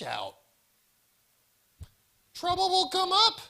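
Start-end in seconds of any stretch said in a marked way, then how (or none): 2.3-3.4 much higher, louder warning; sermon-style ominous warning; dramatic warning. Real, not acted. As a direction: warn them with mock drama. Warning in the analogy.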